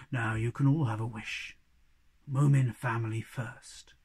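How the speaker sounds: low and grand, like a kindly wizard; low hobgoblin voice. Acted. Hobgoblin granting wishes.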